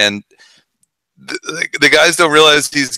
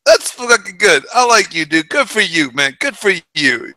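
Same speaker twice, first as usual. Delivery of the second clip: high, hearty praise in an imitated mobster voice; impressed mobster; 5 semitones higher than usual. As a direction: slap him on the back with it, pitched high. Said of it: "he's like".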